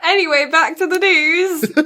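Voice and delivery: high, loud, through leftover laughter; laughing segue. Real, not acted. Co-host steering back to the news, still cracking up. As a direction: push the show on while still laughing.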